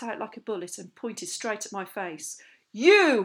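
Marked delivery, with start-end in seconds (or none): 2.7-3.2 high, explosive shout; furious shout. A narrator's reading. Captain singling out a pupil with a furious shout. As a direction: shout it like a drill officer. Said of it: "he shouted".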